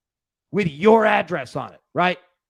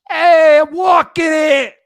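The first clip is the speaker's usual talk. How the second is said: put-on loud, high old-school New York shout; gritty New York yell; 11 semitones higher, 13 dB louder, slower than usual. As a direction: yell it like an eighties New Yorker in the street. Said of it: "the gritty eighties".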